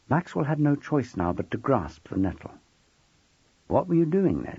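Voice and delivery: ordinary delivery, nothing marked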